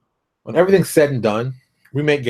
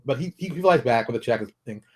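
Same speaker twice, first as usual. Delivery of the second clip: ordinary talk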